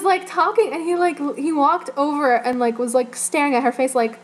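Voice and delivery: ordinary delivery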